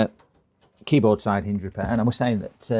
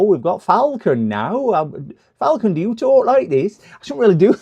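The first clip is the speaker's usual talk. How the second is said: Falcon's West Country voice; 9 semitones higher, 7 dB louder than usual. Shown as a demonstration. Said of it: "one of [name]".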